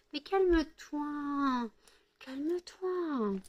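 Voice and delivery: high, slow sing-song to the dog; pet voice. Real, not acted. Owner soothing her excited dog, slow high cooing pet voice. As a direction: coo it slowly, way up high.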